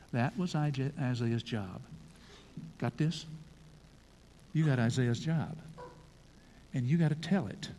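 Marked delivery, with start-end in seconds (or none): none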